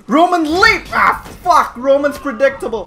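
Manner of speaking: high, loud attack call turning to dismay; frustrated shouting. Real, not acted. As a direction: yell the move and curse its failure. Shouts attack, then fails.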